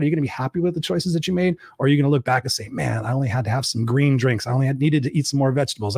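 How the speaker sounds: usual speaking voice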